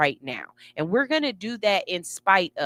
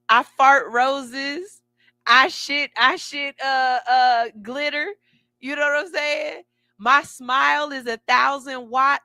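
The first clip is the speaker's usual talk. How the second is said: excited bragging; 5 semitones higher, 4 dB louder than usual. Real, not acted. Playful over-the-top self-praise.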